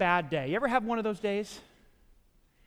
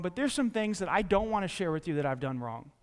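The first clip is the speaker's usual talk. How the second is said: normal talking voice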